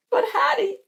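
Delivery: high, protesting character voice; fretful Pearl. Acted. Pearl objecting to Hattie's journal idea, fretful high voice.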